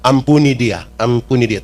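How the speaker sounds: put-on God urging his child to forgive, low and tender; God's voice. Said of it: "Tuhan bilang".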